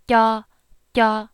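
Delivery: "ya" with a strong puff of air; fresh aspirated ya. Shown as a demonstration. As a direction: blow air out on "ya", loud and fresh. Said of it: "pretend you're on flight one".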